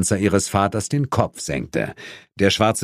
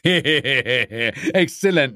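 drawn-out word through a cackle; laughing; 5 semitones higher, much slower than usual. A narrator's reading. Devil gloating through laughter, very slow. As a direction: savour it while still laughing. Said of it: "Asmodes lachte meckernd".

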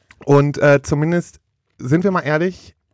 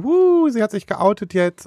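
mock gushing excitement, higher and slow; hyped; 8 semitones higher than usual. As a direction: gush like a thrilled fan. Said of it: "erst waren alle so gehypt". (gespielt begeistert)

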